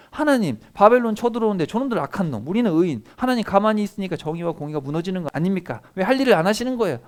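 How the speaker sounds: a prophet accusing God of doing nothing; arguing with God. Retold or imitated. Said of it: "막 따졌단 말이에요".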